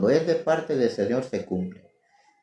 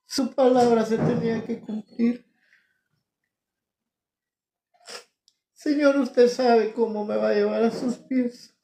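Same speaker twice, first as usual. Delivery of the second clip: humble prayer; 10 semitones higher than usual. Real, not acted. Kneeling prayer retold.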